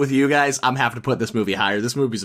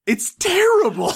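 much higher, laughing; laughing squeal. Real, not acted. Laughing verdict.

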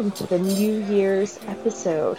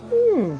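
high sultry hum; 10 semitones higher, 5 dB louder than usual. Acted. Sultry host hums.

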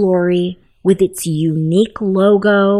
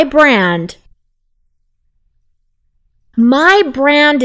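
spoken affirmation; 4 dB louder than usual. Shown as a demonstration. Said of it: "repeat the following affirmation".

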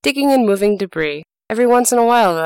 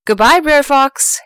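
higher, louder, slow gloating shout; a triumphant rabbit shouting farewell; shouted goodbye; 7 semitones higher, 4 dB louder, slower than usual. Acted. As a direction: crow it as you whizz past. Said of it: "he shouted".

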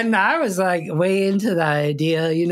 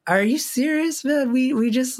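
higher-pitched, disbelieving protest; retold protest, high and incredulous; incredulous retort; 8 semitones higher than usual. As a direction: push back in disbelief, voice raised in pitch. Incredulous reply to refund request.

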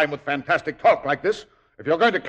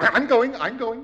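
scared; 10 semitones higher than usual. Acted. Being thrown out.

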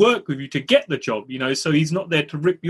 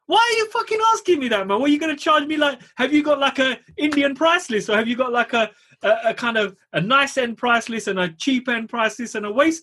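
mock outrage; 7 semitones higher than usual. Outraged rant at nosy builders.

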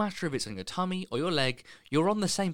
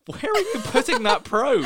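hammed-up outraged question, high, loud and drawn out; mock alarm; 13 semitones higher, 7 dB louder than usual. To the sonographer in disbelief, mock alarm. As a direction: demand it in laughing horror. Acting outrage at imagined internal scan.

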